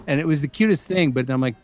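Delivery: usual speaking voice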